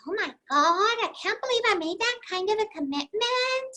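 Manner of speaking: high, alarmed little voice; panicky inner voice. Retold or imitated. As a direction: squeak out the regret.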